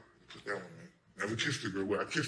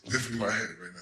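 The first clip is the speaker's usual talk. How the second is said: usual speaking voice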